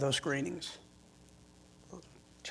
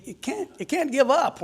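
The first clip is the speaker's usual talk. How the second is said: urging; 9 semitones higher than usual. Retold or imitated. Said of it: "they'd always tell me".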